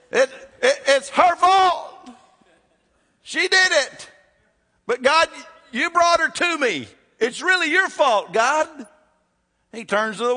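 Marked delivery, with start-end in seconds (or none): 0.1-8.7 high, loud whining excuse shouted at God; whiny Adam. Retold or imitated. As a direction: play Adam as a high-pitched complainer dodging blame. Preacher acts Adam blaming God.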